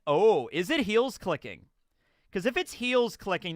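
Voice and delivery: usual speaking voice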